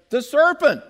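sudden loud high cry of blame; Eve blaming the serpent. Retold or imitated. Preacher voices Eve blaming the serpent, loud and high. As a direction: point the finger hard. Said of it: "The woman said".

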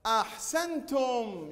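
ordinary talk

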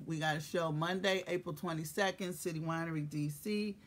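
ordinary talk

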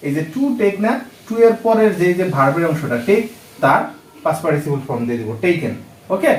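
ordinary delivery

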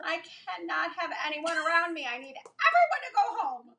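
high, polite customer service voice; chirpy polite voice on the phone. Retold or imitated. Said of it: "your customer service voice".

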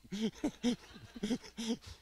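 loud high laugh; high burst of laughter. Real, not acted. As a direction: let a big laugh out. Crowd laughs at his joke.

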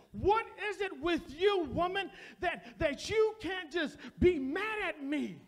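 a broken man demanding why she won't fight; exasperated cry. Retold or imitated. Husband overwhelmed by his wife's kindness, loud pleading cry. Husband collapses, cries out at wife.

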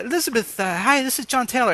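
imitating an editor's fake British accent; mock British accent. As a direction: put on a fake posh British accent to prank-call. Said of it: "I do a great British accent".

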